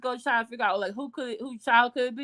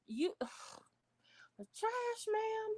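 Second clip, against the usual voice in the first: slow, breathy whisper, pitch high; disgusted whisper; 10 semitones higher, 12 dB quieter, much slower than usual. Real, not acted. Viewers hear her judge the mother, in a whisper. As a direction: hiss it out in disbelief.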